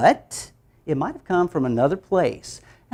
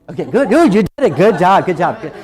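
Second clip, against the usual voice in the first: put-on praising kids; 2 semitones higher, 6 dB louder than usual. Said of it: "I'd be walking around and say".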